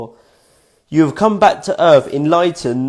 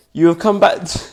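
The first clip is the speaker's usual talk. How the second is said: ordinary talk, nothing marked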